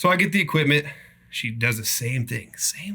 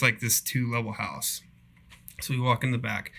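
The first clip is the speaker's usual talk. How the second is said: normal talking voice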